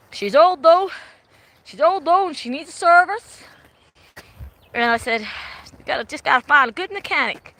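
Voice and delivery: broad Aussie. Retold or imitated. Asking the man for a mechanic, broad Australian accent. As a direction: play the battler with the old ute.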